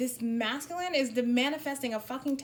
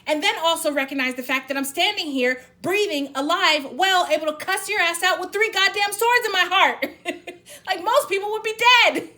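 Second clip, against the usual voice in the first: louder and higher, half laughing; furious laughing tirade, higher and louder; loud channelled rant; 8 semitones higher, 11 dB louder than usual. Acted. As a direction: yell it out with a laughing edge. Channelled rant at divine masculine.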